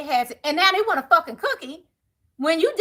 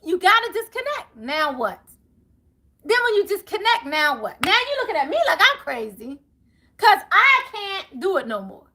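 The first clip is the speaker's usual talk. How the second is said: ordinary delivery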